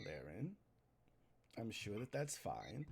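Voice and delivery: calm him down gently, low; low, slow reassurance. Acted. Patient reassuring Laren, low and slow.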